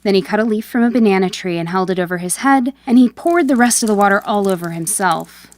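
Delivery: plain reading voice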